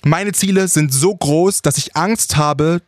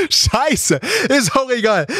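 high, giggling through the words; stifled giggles; 8 semitones higher, slower than usual. Real, not acted. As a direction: laugh it off while speaking. Lacht nach Rechenfehler. (unterdrücktes Kichern)

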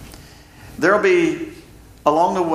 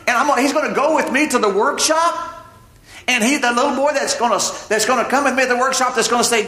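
amazed Joseph; 5 semitones higher than usual. Retold or imitated. Joseph marvels at fatherhood.

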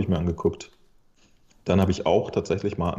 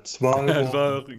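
mechanisch wiederholt wie eine defekte Maske; kaputte Maske; 6 semitones higher than usual. Retold or imitated. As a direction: steif und roboterhaft. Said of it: "sagt immer wieder". (Stimme einer kaputten Maske)

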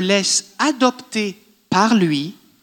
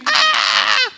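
shouted cry; 13 semitones higher than usual. Retold or imitated. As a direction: let out a wordless shout. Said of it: "quelqu'un qui fait".

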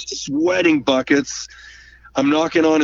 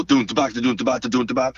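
vocal drum beat; 3 semitones lower, faster than usual. Shown as a demonstration. Mimicking a drum shuffle beat.

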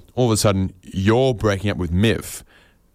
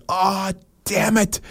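high, slow, dismayed groan; ex-girlfriend's dismay; 11 semitones higher, slower than usual. Acted. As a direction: drag it out, high and deflated. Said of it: "And she goes".

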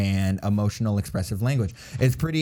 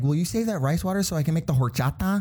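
higher, quick coworker imitation with Spanish-accented horchata; Spanish accent on loanwords; 7 semitones higher, faster than usual. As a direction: slip into a Spanish accent on the last word. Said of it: "he says it in an accent".

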